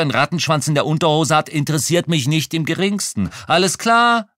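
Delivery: loud, high, heated rant; getting worked up and yelling; angry outburst. Acted. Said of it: "Ich kam in Fahrt".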